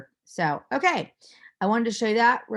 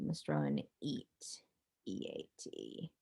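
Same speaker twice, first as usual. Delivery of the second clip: whispering; 14 dB quieter than usual. Shown as a demonstration. Teacher spells word quietly.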